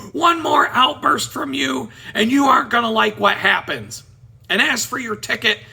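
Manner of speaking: imitated judge's loud reprimand; angry judge warning. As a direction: lay down the law as a fed-up judge. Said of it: "he gets mad at the guy".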